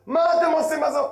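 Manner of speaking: high, loud scornful question; mock challenge. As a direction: challenge them loudly, high-pitched. Voices the wicked son's challenge.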